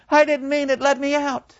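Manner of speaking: high, pleading wail from the lake; evil soul crying out. Acted. The drowning evil soul begs the victims on the bank, high and pleading. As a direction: beg to be let out. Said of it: "the evil soul cries out".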